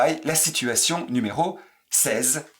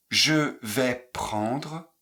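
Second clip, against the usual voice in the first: je vais prendre, very slow and separated; slow syllable drill; much slower than usual. Shown as a demonstration. Teacher drilling students, very slowly. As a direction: stretch each syllable out slowly. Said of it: "vous les répétez exactement comme je les prononce".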